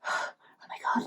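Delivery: weak, voiceless gasp of words; faint whisper. Acted. Star laying on the floor, faintly whispering. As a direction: whisper it as if nearly spent.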